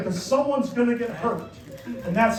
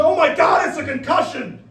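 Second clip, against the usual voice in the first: panicked parent; 5 semitones higher than usual. Retold or imitated. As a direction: imitate an overreacting parent shrieking in alarm. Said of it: "we went from".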